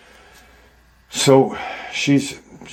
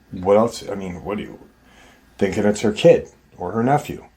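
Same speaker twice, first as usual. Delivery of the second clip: normal talking voice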